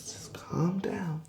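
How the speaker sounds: whispered, slow and soft; whispering. Acted. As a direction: hush it out in a whisper. Telling her to calm down.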